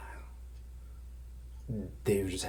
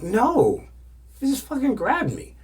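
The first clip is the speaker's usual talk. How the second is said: high, loud mock upset; imitated indignant protest; 8 dB louder than usual. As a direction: protest as a flustered, offended woman. Woman protesting she doesn't know them.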